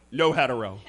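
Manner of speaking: low, soft aside; deadpan disclaimer. Acted. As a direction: tack it on low and under your voice. Deadpan tag on bar order.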